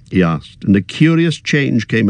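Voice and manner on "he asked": ordinary delivery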